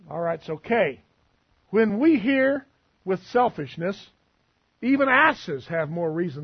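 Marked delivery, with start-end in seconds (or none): none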